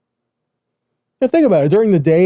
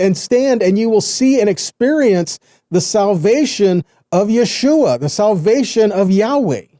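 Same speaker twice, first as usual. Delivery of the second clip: fervent preaching; 5 semitones higher than usual. Acted. Preacher urging his listeners to stand firm, rising fervor. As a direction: lift the congregation with urgent conviction.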